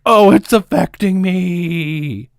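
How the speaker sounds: slow, loud mocking whine of a virtue-signaller; hammed-up self-pitying boycotter; mocking whiny voice. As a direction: sneer it out, drawn out and loud. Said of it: "be like".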